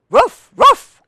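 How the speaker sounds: high, loud, sudden barks; dog barking. A narrator's reading. Reader shows off a dog sound, high loud barking. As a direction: bark like an excited little dog. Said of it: "Barked the dog".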